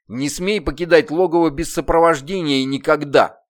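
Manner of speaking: loud, stern demon order. Acted. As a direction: lay down the law. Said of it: "строго произнёс".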